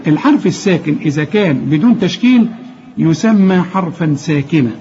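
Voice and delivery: ordinary talk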